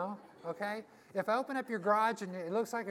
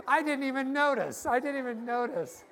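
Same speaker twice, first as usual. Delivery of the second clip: laughing loudly; 5 semitones higher, 10 dB louder than usual. Real, not acted. Laughing at the teeth story.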